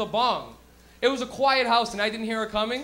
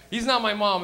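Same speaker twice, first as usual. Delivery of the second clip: normal talking voice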